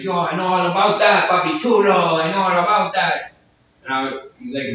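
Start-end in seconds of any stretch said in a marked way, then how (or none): 0.0-3.3 heckler voice. Retold or imitated. Heckler voiced in a retold story.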